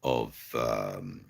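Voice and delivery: low, soft and slow, lulling; dulcet bedtime tones. Pretend. Streamer offers viewer Maggie his accent as a bedtime lullaby, dulcet tones. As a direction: lull them to sleep with the accent. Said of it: "enjoy the dulcet tones".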